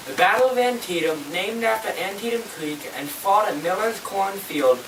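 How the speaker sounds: usual speaking voice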